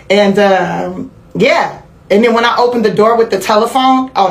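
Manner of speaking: normal talking voice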